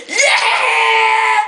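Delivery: excited shout. Acted. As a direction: shout it out with glee. Whacking with baseball bat.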